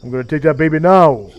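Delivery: loud mock tough guy, exploding on "now"; thug babysitter. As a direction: imitate a thug, punch the final word. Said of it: "that character is what I based Anbu on".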